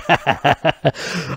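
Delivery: slow words stretched through a laugh. Real, not acted. Laughing at himself while checking IMDB. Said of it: "repeats the line laughing".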